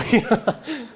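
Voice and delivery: high bursting laugh; real burst of laughter. Real, not acted. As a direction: let a real laugh escape. Laughing at own joke.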